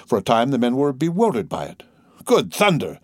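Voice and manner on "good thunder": higher, stunned outburst; startled exclamation. A narrator's reading. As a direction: exclaim it in disbelief. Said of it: "they ejaculated".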